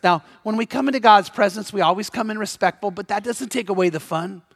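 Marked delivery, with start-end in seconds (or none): none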